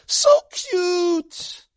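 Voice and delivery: very high, drawn-out coo; gushing nurses. Acted. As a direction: squeal over a newborn like doting nurses. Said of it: "The nurses would say".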